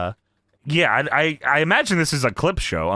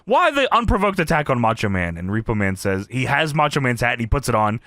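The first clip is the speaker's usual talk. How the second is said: normal talking voice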